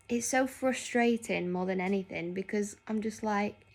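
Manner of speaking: usual speaking voice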